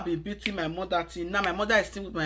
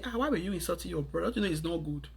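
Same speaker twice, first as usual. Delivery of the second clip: hushed and quick, much softer; quiet private scolding; 3 semitones lower, 7 dB quieter, much faster than usual. Shown as a demonstration. Mother quietly chiding daughter in private, hushed and fast. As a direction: scold her gently so nobody hears. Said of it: "in your closet ... call your daughter and say".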